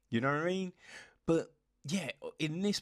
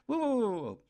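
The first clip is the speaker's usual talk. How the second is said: mock alarm; 9 semitones higher, 4 dB louder, much faster than usual. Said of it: "you're just like".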